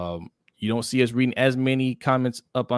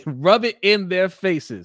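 laughing, slower and louder; laughing gloat; 7 semitones higher, 5 dB louder, slower than usual. Real, not acted. Laughing as he tells friends to rub it in. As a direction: gleefully rub it in.

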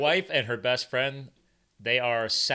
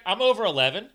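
a lightly voiced cocky dog, higher and louder; voicing the dog; 5 semitones higher, 6 dB louder than usual. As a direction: speak for the three-legged dog, proud and pumped up. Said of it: "He's like".

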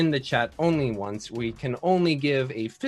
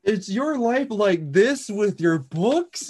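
high, slow, hammed-up sales question; infomercial pitch; 7 semitones higher, 3 dB louder, slower than usual. Streamer mocking an infomercial for players, slow and high. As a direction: ask it like a desperate TV ad.

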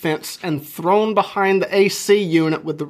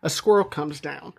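normal talking voice

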